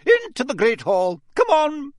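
high, loud shouted order; stern teacher calling over a crowd; sharp command. A narrator's reading. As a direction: herd students along impatiently. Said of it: "said Professor McGonagall sharply".

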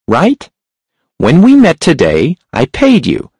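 a sharp, high-pitched hunchback. A narrator's reading. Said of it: "The hunchback's voice was sharp and high".

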